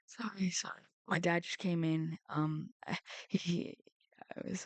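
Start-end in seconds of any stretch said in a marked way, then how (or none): 0.1-0.8 quick whispered sorry; whispered apology. Real, not acted. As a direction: breathe the apology out. Dad walks in mid-recording.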